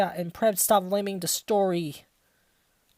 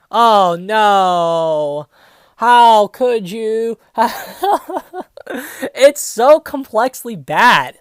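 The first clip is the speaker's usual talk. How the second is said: loud, slow, exasperated laughing groan; laughing despair; 3 semitones higher, 10 dB louder, much slower than usual. Real, not acted. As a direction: howl in disbelief at awful writing. Laughing despair at the bad writing.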